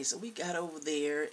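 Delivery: normal talking voice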